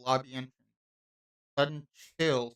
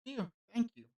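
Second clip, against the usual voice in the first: relieved sigh; 8 semitones higher, 6 dB quieter than usual. Acted. Relieved after drinking water.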